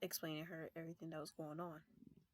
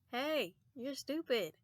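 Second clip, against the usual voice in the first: slow, louder mocking call; sing-song tease; teasing; 6 semitones higher, 7 dB louder, much slower than usual. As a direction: draw it out to taunt him. Teasing brother through door.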